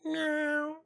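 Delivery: high kitty mew at the door; cute cat meow. Pretend. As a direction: mimic a cat asking to be let in. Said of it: "Would you let me in".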